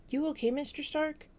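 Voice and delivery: a hint of a worried kid asking; Peter's voice. A narrator's reading. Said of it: "Peter asks".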